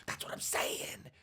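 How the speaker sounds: breathy mocking whisper. Whispering gossip about a friend, unvoiced. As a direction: hush it like gossip. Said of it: "she's trying to whisper".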